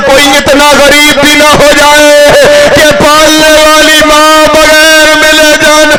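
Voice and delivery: retold tearful plea. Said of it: "Sughra نے رو کے کہا". (روتی ہوئی فریاد کی نقل)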